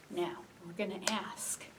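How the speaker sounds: ordinary delivery, nothing marked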